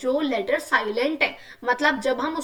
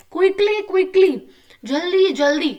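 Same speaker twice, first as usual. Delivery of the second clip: high, loud urgent quickly quickly; lively rhyme line; 6 semitones higher, 5 dB louder, much slower than usual. Shown as a demonstration. Teacher reading rhyme to kids, high playful urgency. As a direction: call it out playfully like a chase. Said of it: "छोटी सी बोल लिखी है".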